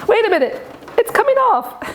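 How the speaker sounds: high, delighted surprise; can't believe it's coming off; excited. Real, not acted. Housing pops off, surprised.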